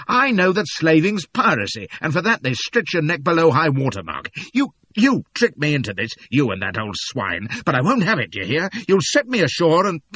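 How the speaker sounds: high, loud angry protest; furious shouting. Acted. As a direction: rage and threaten. Narrator raging at the captain.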